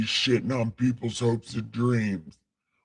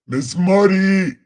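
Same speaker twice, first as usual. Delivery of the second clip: loud, slow, higher call; 8 semitones higher, 8 dB louder, slower than usual. Real, not acted. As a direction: call across the house. Calling for Miss Muddy.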